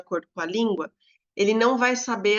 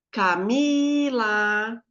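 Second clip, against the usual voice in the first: CAMILA called slowly with MI held long; drawn-out summoning "Camila", weight on MI; stress on MI; 3 dB louder, much slower than usual. Shown as a demonstration. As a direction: call out "Camila", stretching the MI. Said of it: "o mi é mais forte".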